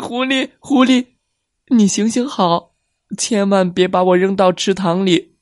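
high, whimpering fake sobs; crying tortoise. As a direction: sob and beg as the tortoise. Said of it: "哇的一声哭了".

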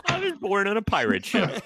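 laughing while holding the tongue; holding tongue with fingers. Pretend. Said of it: "Hold your tongue and say that".

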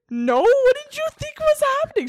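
high, loud protest; squealed, disbelieving comeback; mock indignant denial. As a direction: imitate being scandalized by the question. Acted indignant denial in retold exchange.

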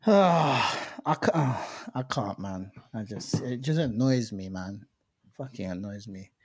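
low, soft frustrated groan. Real, not acted. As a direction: groan in disbelief and annoyance. Groaning at missed sneaker money.

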